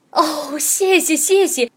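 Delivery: slow, delighted thanks; happy goose. Acted. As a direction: gush your thanks happily. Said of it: "公鹅开心地嘎嘎直叫".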